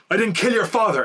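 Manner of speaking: high, loud hammed-up plea; soap opera line. Daredevil to Elektra, hammed-up soap plea. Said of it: "goes to Elektra".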